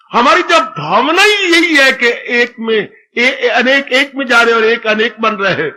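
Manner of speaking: normal talking voice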